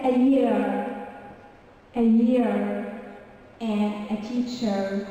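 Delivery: slow, fully sounded American R; American accent. Shown as a demonstration. Teacher shows the R is never silent, American accent. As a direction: hold the final R, American style. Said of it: "In the American sound, they say".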